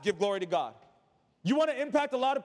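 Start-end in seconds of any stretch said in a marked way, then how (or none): none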